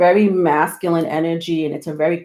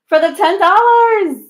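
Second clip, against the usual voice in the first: laughing; 10 semitones higher, 5 dB louder than usual. Real, not acted. Laughing thanks for donation.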